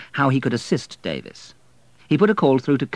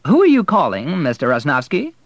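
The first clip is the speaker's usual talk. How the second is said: prim, high secretary's voice, louder; a proper receptionist asking primly; lightly voiced prim lady on the line; 6 semitones higher, 6 dB louder than usual. A narrator's reading. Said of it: "asked a prim-sounding lady".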